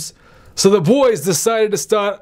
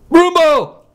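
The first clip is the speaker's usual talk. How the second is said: higher, louder grand announcement; announcing shout; 6 semitones higher, 4 dB louder than usual. Acted. Grandpa proclaiming the game's name, loud and grand. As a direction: boom it out like a sports announcer. Grandpa naming the new game.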